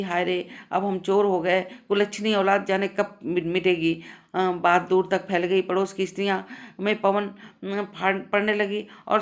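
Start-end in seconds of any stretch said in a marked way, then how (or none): none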